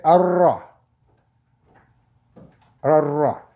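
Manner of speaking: arra with the r doubled and no l sound; doubled r, silent lam. Shown as a demonstration. Teacher explaining the silent lam, a doubled arra. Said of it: "lam va disparaître".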